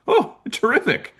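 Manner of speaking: very high-pitched mock delight; squeaky-high happy surprise, imitated; retold delight. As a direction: act out a thrilled reaction to handy news. Acted delighted reaction to news.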